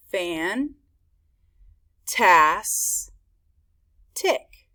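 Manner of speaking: Fan, tas, tick with long pauses between; each beat of Fan, tas, tick on its own; syllable by syllable. Shown as a demonstration. Teacher pointing out three syllables, broken up. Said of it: "That's three syllables".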